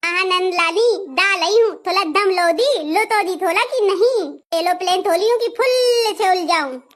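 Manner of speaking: put-on babyish lisp, r turned to l. Bride asking eager sister-in-law to wait, lisping. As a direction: lisp through it with softened consonants. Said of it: "तोतली भाभी की जय हो".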